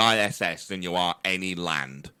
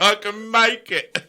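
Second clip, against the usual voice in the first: high, slow, giggling bravado; laughing drunken confidence; 11 semitones higher, slower than usual. Pretend. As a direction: say it like a tipsy daredevil. Repeats higher, laughing, slower.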